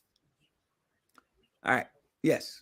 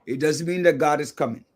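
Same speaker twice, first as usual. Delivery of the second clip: ordinary delivery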